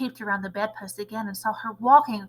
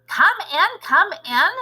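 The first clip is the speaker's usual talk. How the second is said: impatient snap, higher and louder; snapping; 7 semitones higher, 6 dB louder, slower than usual. A narrator's reading. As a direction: order them in, irritated. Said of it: "snapped the Grand High Witch".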